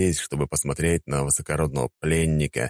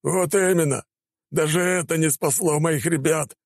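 howling cry; 12 semitones higher than usual. Acted. Broken officer howls to commander, anguished. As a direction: wail for lost men. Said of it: "взвыл бедолага".

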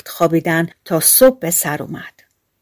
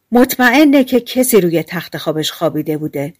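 ordinary delivery

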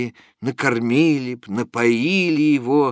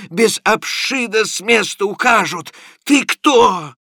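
high, loud, slow accusing voice; a bully lecturing a sick man; mocking, indignant rant; scolding clerk; 9 semitones higher, 3 dB louder than usual. A narrator's reading. Zimovejkin berates Prokharchin.

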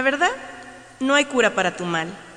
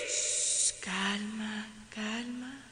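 whispering; 11 dB quieter, much slower than usual. Acted. Calming herself.

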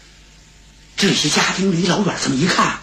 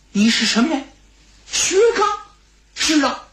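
high-pitched, astonished question and answer; startled recognition; 9 semitones higher than usual. Acted. Old steward recognising the long-lost visitor, astonished. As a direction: let disbelief lift the voice.

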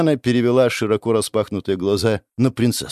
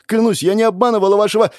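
fast, higher and louder plea; desperate pleading; 11 semitones higher, 5 dB louder, faster than usual. Acted. Princess swears to the goddess, frantic and loud. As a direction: rush the denial in panic.

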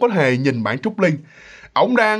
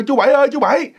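high acted call for uncle; 8 semitones higher, faster than usual. Husband calls Uncle Bảy to come in, acted calling out. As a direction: raise the pitch and summon someone from another room. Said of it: "Kêu ông Bảy vô".

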